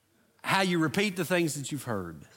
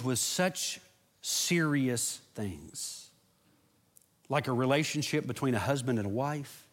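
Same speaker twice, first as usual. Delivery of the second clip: closing prayer. Acted. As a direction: pray gently over the congregation. Pastor leads closing prayer.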